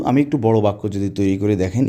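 usual speaking voice

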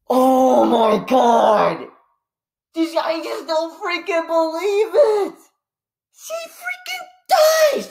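wailing in theatrical grief, slow and high; mock crying. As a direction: stretch it out into a hammed-up sob. Mock grief over Aunt May.

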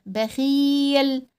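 بخيل with a held vowel; long ii in بخيل. Shown as a demonstration. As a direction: stretch the ii of بخيل. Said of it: "بيطول صوته ازاي".

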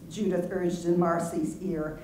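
ordinary delivery

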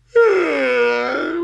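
high, loud, long mew; cat's meow. A narrator's reading. Cat settles back to sleep, drawn-out meow.

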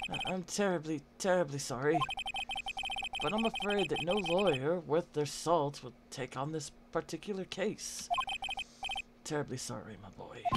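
low, quiet, faltering apology; regretful old lawyer. Acted. Lawyer refusing the case to his boy, low and regretful. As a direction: trail away softly in a low elder's register.

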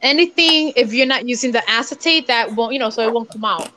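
usual speaking voice

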